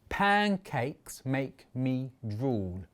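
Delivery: "Pancakes make me drool" in five slow, separate beats; slow, spaced "Pancakes make me drool"; syllable by syllable. Shown as a demonstration. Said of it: "I only need five".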